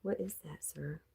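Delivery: ordinary delivery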